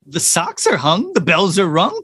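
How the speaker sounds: mildly desperate storybook cry; a touch of urgency in the rhythm; desperate Santa. A narrator's reading. Santa answers the unmoved reindeer, mildly desperate. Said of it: "cried Santa desperately".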